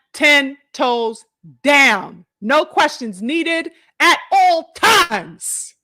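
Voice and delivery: ten toes down stretched out very slowly; slow emphatic praise ending in a laugh. Real, not acted. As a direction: drag each word out to underline it.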